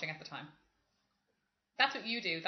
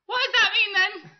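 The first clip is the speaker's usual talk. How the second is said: much higher, louder mock-innocent question; 11 semitones higher, 10 dB louder than usual. Teller mimics her sister loudly asking on the train. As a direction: ask it loudly for all to hear. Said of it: "she goes".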